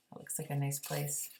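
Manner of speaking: ordinary delivery, nothing marked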